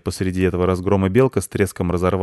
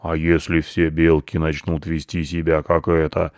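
ponderous bear voice, slightly lowered; imitated bear reprimand; great bear scolding; 3 semitones lower than usual. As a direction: lecture like a stern old forest ruler. Squirrel quotes the great bear's scolding.

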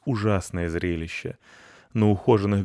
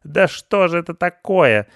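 high, loud outrage; indignant outcry; 10 semitones higher, 9 dB louder than usual. A narrator's reading. Pensioner seeing her flowerbed wrecked, indignant. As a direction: cry out scandalized. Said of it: "возмущалась Петровна".

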